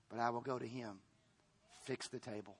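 low, soft, settled voice; imitating resigned David. Bereaved David telling his servants he will go to him, quietly resigned. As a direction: drop down quietly into calm acceptance.

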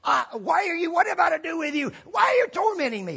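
high shouted demon protest; shouting demon. A narrator's reading. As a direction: yell it as the tormented devil. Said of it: "the devil said".

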